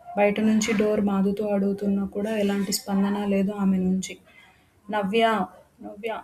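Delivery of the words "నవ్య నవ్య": a graver, sterner call; more serious. A narrator's reading. Ravan calling Navya again behind the locked door, gravely. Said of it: "ఈసారి మరింత గంభీర్యంగా".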